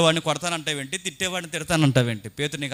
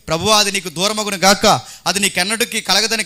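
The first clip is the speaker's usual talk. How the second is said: rebuking; 5 semitones higher, 8 dB louder than usual. Retold or imitated. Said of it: "గద్దింపసాగును".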